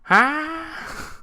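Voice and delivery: high, bewildered huh; baffled huh. Retold or imitated. Reacting to the film scene, high baffled huh. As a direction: sound completely lost. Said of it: "at that stage of just like".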